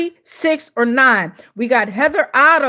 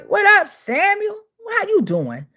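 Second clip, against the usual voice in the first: ordinary delivery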